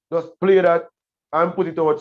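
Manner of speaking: normal talking voice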